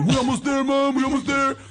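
put-on high, childish teasing voice; high kid voice. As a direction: tease like a little kid. Kid talking from the trunk.

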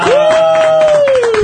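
loud high cheer; cheering woo. Real, not acted. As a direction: whoop for the guest. Cheering the guest.

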